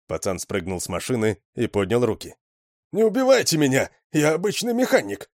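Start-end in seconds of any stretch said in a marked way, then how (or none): 2.9-5.3 high, frightened begging; pleading. A narrator's reading. Young mechanic begs not to be shot, high and frightened. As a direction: beg for your life.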